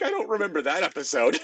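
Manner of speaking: laughing confusion. Retold or imitated.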